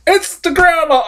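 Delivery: high, mocking quote of the movie character; hammed-up high movie quote; mocking movie line. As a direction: squeak it out like a cheesy movie line. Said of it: "break the fourth wall and go".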